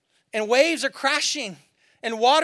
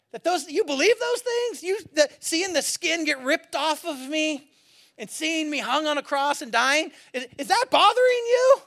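teasing disbelief; 4 semitones higher than usual. Retold or imitated. Jesus teasing doubting disciples about the crucifixion, mock incredulous. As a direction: act baffled, building to a high-pitched ask. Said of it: "Jesus has a sense of humor".

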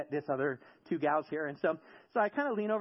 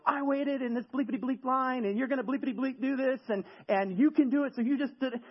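higher-pitched imitation of an agitated complaint; mock an agitated customer, voice pitched up; retold raised voice; 6 semitones higher than usual. Irate customer at a coffee counter berating the worker, mildly mimicked raised voice. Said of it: "his voice is getting raised".

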